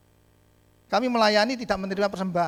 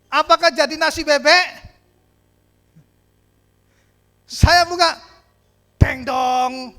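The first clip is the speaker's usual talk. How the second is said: loud, rising suspense; loud suspenseful build-up; building excitement; 7 semitones higher, 9 dB louder, slower than usual. Retold or imitated. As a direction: climb to the reveal, loud and high.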